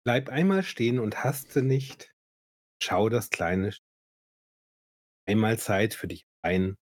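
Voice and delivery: slow, measured poem reading; solemn Advent poem read with spaced pauses; poem recitation. Acted. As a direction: recite the verse line by line, unhurried. Reads a Christmas poem aloud.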